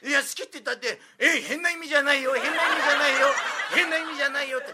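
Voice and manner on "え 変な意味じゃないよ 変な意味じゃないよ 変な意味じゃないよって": put-on higher, louder, laughing as the old master insists; laughing mimicry of master. Old master insisting to him, laughing imitation. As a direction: repeat it through laughter like the fussy old master. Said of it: "毎回言われる".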